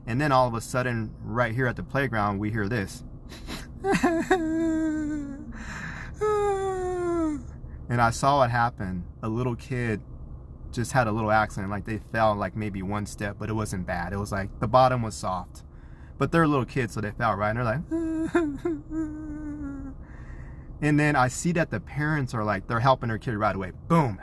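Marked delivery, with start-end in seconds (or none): 3.1-7.8 long, high mock crying; imitated child's wail. Imitates hurt child's crying heard. 18.0-20.8 high, softer mock wail; imitated kid crying. Imitates fallen little kids crying.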